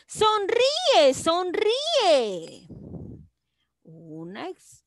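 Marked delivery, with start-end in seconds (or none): 0.1-2.7 muy aguda y enérgica, cayendo al final; exclamación emocionada. Shown as a demonstration. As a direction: anima con entusiasmo en tono muy agudo. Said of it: "emoción también". (exclamación entusiasta y aguda)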